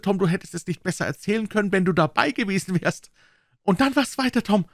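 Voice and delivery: a child's dream retold with wonder; a hint of an excited household scene; Aunt Polly and Tom.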